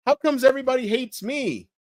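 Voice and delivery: high-pitched mock complaint; mocked put-on voice. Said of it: "I don't talk like this".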